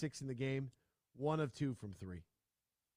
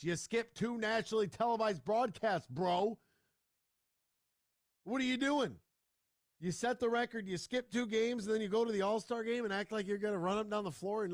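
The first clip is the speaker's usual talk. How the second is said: higher and louder, incredulous scolding; exasperated rant; 8 semitones higher, 5 dB louder than usual. Real, not acted. Host berating a basketball star over skipped games, exasperated rant. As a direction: tear into him, voice raised.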